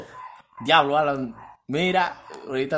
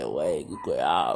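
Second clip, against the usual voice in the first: mock deep voice; 13 semitones lower, 4 dB quieter, slower than usual. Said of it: "Alan le mandó una nota".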